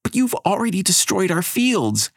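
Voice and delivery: put-on high girl's outburst; child shouting. Abigail shouts at Elena about the fields, high. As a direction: accuse her outright. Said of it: "Abigail shouts".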